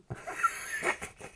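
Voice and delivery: quiet breathy chuckle; soft embarrassed laugh. Real, not acted. Laughing quietly at his own vocal take. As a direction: laugh it off softly.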